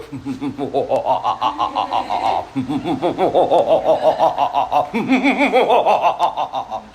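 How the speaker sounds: long rolling acted laugh; Jibril's laugh. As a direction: let out a long hearty laugh. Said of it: "Jibril turun ketawa". (ketawa panjang Jibril)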